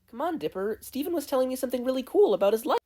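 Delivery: bubbly sister gushing about her friend; high eager Mabel, rushing. Acted. Mabel voiced by narrator.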